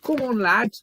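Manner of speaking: high, loud Scouse cheer; Scouse accent. Pretend. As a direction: put on a Scouse accent and urge him on loudly. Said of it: "Do my little Scouse accent".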